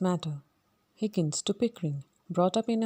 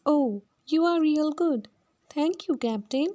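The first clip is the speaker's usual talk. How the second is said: put-on higher Cockney girl's voice, pleased; Eliza; 8 semitones higher than usual. Eliza thanks the Captain, pitched high and delighted. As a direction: gush up high like a delighted flower girl.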